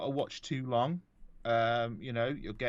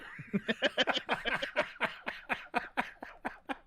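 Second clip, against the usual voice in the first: breathy high wheeze laugh. Real, not acted.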